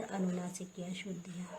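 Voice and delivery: ordinary talk, nothing marked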